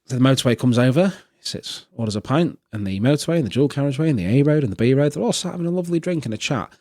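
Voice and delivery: usual speaking voice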